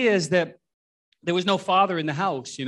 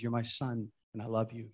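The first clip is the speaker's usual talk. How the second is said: soft voice of God; 6 semitones lower, 12 dB quieter than usual. Retold or imitated. God's voice heard in snowstorm.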